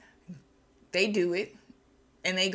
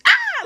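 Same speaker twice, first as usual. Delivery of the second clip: loud, very high scream; mock scream; 15 dB louder than usual. Milliner sees a mouse on the hat, screaming. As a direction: shriek out as the startled milliner. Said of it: "She's, she screams".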